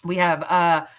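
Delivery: normal talking voice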